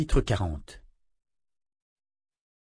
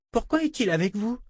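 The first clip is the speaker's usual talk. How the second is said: put-on high, eager little girl's voice; a delighted small child gushing; child voice full of admiration; little girl Rachel; 11 semitones higher than usual.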